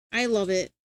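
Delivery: ordinary delivery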